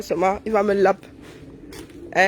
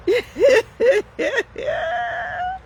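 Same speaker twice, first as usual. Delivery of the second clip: shrieking laugh. Real, not acted. Rire criard. (rire criard aigu)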